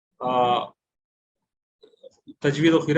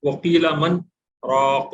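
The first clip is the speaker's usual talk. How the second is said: "वकीला मन", a short held-breath stop, then "राक"; brief breathless pause; 3 dB louder, slower than usual. Shown as a demonstration. Teacher demonstrating a Quran recitation rule, brief pause after "मन". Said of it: "वहाँ पे सकता हो रहा है".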